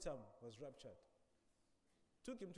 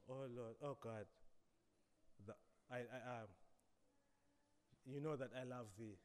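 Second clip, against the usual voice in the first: softer, halting, searching for words; flustered Peter; 5 semitones lower, 6 dB quieter than usual. Acted. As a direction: stumble through it, humbled and quiet.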